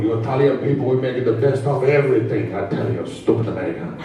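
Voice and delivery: put-on thick Italian accent, gruff and scolding; Italian dad accent. Father scolding his American son, thick Italian accent.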